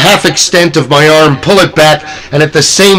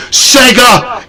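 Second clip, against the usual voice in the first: short high-pitched call; mock yell; 7 semitones higher than usual. Imitating Gilman popping his head through at guests, high mock yell. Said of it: "Did that guy just yell Sega".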